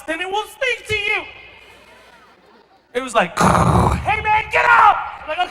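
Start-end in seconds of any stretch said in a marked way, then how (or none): 0.0-1.2 emphatic punchline. Acted. Punchline about house speaking. 3.4-5.0 urgent yell. Acted. Bombed house yells at him.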